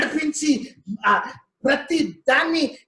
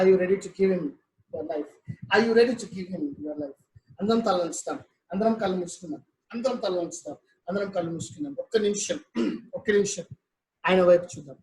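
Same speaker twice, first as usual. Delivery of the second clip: softer, intimate appeal; hushed altar call; 6 semitones lower, 4 dB quieter, faster than usual. Acted. Pastor quietly urging the congregation to give their lives. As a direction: draw them in quietly to surrender.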